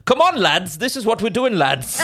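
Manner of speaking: put-on thick German accent. Comedian who's developed a tick of saying lads, thick German accent. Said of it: "this really thick German accent".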